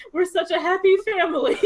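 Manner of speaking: laughing. Acted. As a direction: hug them close and laugh it out. Ember laughs, grabbing family.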